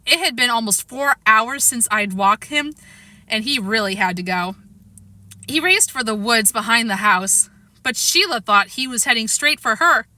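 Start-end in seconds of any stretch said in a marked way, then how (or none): none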